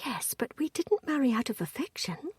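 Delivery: breathy hushed whisper with no voice. A narrator's reading. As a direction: whisper it, half hesitant. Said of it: "she whispered".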